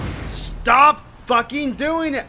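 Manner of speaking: loud, drawn-out angry scolding; exasperated yell. Real, not acted. Player scolds the misbehaving game, exasperated yell. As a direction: yell at it in real frustration.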